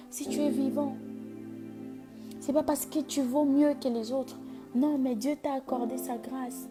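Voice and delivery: high, loud building sermon; preaching at full force. Acted. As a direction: climb into a fiery altar call. Prédication montante sur musique. (prédication enflammée)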